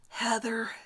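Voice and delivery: breathy whispered name. Acted. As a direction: whisper the name to yourself, dreamy. Name repeating in his mind.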